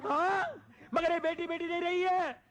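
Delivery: high, anguished scandal announcement; melodramatic outcry. Acted. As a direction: build to a shocked high-pitched reveal.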